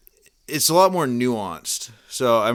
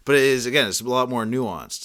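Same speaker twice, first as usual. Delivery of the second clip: ordinary delivery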